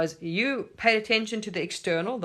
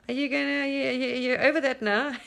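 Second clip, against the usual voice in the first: mock teasing; 7 semitones higher than usual. Teasing test from Source.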